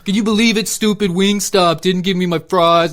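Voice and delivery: high, loud whiny rant; mock outraged complaint. Customer griping about stupid Wingstop, mock outrage.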